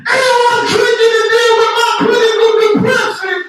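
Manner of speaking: very high, blaring DJ shout; overtalking club DJ. Acted. Comic acting a DJ who drowns the music, shouting high. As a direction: yell over the music like an obnoxious DJ. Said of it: "the D J keeps talking too much".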